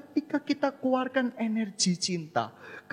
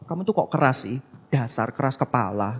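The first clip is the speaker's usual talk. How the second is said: put-on louder, lower scolding of a stubborn child; mock scolding parent; 6 semitones lower, 5 dB louder than usual. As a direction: nag the child harshly.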